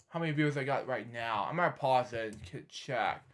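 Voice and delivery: low, hushed aside; talking to oneself. Real, not acted. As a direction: murmur it as you check something. Talking to self while checking views.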